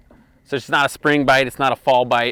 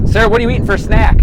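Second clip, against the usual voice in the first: calling out; 9 semitones higher, 7 dB louder, faster than usual. Real, not acted. Calling across the boat.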